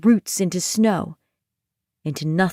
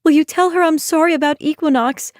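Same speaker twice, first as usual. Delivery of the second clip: rushed apology; 8 semitones higher, faster than usual. Acted. Ione's voice.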